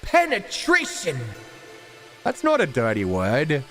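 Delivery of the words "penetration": high, slow, laughing exclamation; gleeful cry. Real, not acted. Reacting to a cheeky chat message, high gleeful cry. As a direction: squeal it out in delight. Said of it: "Somebody spelled it out".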